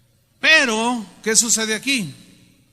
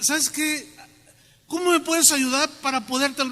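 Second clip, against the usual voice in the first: higher, begging debtor voice; pleading debtor; 6 semitones higher than usual. Acted. Debtor begging his lender, higher pleading voice. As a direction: plead like a ruined man asking help.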